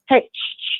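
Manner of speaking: whispered shushing at the dogs; hushing the dogs under her breath. Real, not acted. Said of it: "Sorry, I'm yelling at my dogs".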